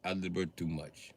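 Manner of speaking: put-on soft, low Russian accent, wearily backing off; quiet Russian voice saying enough. Russia telling the US to stop, in a quiet Russian accent. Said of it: "Even Russia was like".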